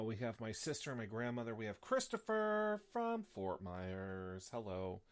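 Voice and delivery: ordinary delivery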